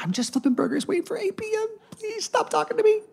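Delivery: very high, soft mock plea; small high-pitched voice, playing the uncomfortable teen; squeaky pleading aside. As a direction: squeak it out under your breath, begging to be left alone. Acting his awkward teenage inner reaction.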